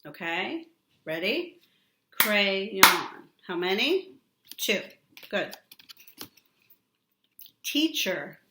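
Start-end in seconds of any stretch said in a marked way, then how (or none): 2.1-3.3 "Crayon" louder, split into two beats; loud syllable-clapping demo; clapped syllables. Shown as a demonstration. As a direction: clap out each syllable of "Crayon". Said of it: "Can you do it?".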